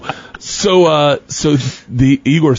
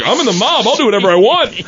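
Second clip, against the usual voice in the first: retold boast; 7 semitones higher than usual.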